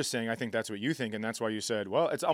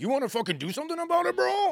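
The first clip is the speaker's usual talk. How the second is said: high, fast taunting bro voice; tough bro; faster than usual. Retold or imitated. Drunk husband snapping at his wife, high bro taunt. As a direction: sneer it like a drunk picking a fight. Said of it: "He's like".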